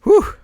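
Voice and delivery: breathless high whew, blown out; out of breath. Real, not acted. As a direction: exhale like you just ran. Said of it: "I'm out of breath".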